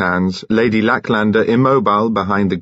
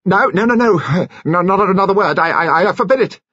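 high, stammering protest; panicked gabble; 11 semitones higher, 3 dB louder than usual. A narrator's reading. Mister Finn forbidding Lady Lacklander to confess, panicked gabble. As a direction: gabble it out in a panic, pitched right up. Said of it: "suddenly began to gabble".

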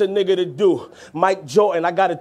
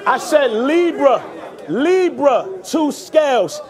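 high, raised repeat of the punchline; emphatic call-back; 4 semitones higher, slower than usual. Acted. Rapper repeating a punchline to the crowd, emphatic call-back. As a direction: drive each phrase up over the crowd.

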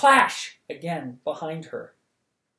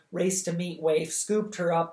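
usual speaking voice

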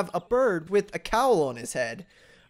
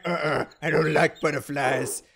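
grumpy Santa; 5 semitones lower than usual. Retold or imitated. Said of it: "He's like".

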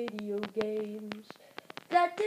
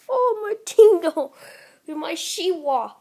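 mock pain; 9 dB louder than usual. Real, not acted. Kid reacting to his character being hit, loud cry.

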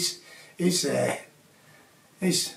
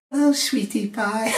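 Glasgow fella's sweet talk; 5 semitones higher than usual. Retold or imitated. Glasgow fella greeting his girlfriend sweetly. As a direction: croon it to your girlfriend. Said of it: "you Glasgow fellas like saying that".

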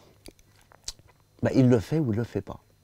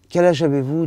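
higher, louder firm question; Kessel asking loudly; 5 semitones higher, 8 dB louder than usual. Retold or imitated. Writer questioning the boy on the phone, loud. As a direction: ask it with a booming authority. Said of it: "lui dit Kessel".

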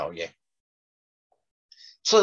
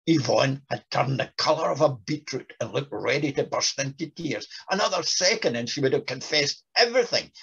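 ordinary talk

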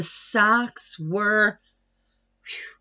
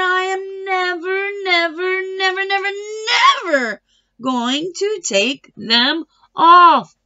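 high, loud, never repeated; stubborn shouting; 7 semitones higher, 3 dB louder than usual. A narrator's reading. Tina refusing the kids, shouting stubbornly. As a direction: dig in and refuse at full voice. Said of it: "said Tina".

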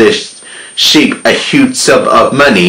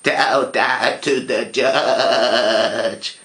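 sheep's retort; 9 dB quieter, much slower than usual. A narrator's reading. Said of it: "the sheep said".

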